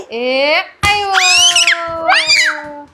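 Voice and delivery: long drawn-out teasing warning; mock-menacing "coming to get you" call; playful chase call. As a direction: stretch each word out like a game of chase. Playful chase game with toddler.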